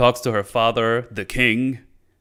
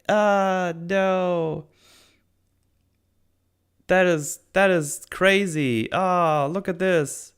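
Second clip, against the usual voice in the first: higher, hammed-up panicked fretting; mock panic; 5 semitones higher, slower than usual. Nori panicking at her freezing hand, mock fearful. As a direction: fake a fluster over the frozen hand. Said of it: "she panics and goes like".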